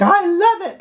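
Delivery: high-pitched imitation of an adoring fan; mock gushing fan. Mimics people who love it.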